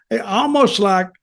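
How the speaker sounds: ordinary talk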